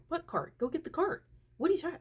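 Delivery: high-pitched retold exasperation; incredulous demand. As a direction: push back in a high, disbelieving voice. Retold disbelief at the nurse.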